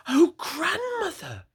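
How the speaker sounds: put-on high, slow, drawn-out little girl's dismay; worried little girl. Girl alarmed at Grandmother's look.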